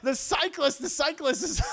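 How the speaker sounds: ordinary delivery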